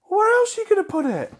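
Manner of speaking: high, loud incredulous question; exasperated shout. Real, not acted. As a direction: squawk it in disbelief. Exasperated argument about tongue.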